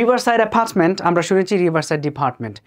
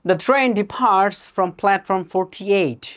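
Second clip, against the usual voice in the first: every word drawn out very slowly, exam-listening style; slow dictation read; 2 semitones higher, much slower than usual. Shown as a demonstration. Teacher reading a listening-test sentence to students: very slow dictation. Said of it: "next কইলো কি".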